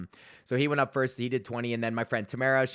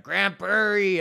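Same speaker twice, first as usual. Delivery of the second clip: put-on loud, higher drunk heckler yell; drunk shout; 7 semitones higher, 5 dB louder, much slower than usual. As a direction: holler it like a drunk in the crowd. Drunk heckler shouting city names.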